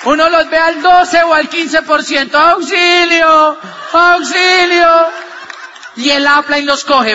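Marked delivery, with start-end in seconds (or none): 2.4-4.9 higher, louder, drawn-out mock yell for help; mock cry for help. Speaker mimics stranded members on the cable, hammed-up yell. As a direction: play the stranded rider begging for rescue. Retold stuck distributors crying out.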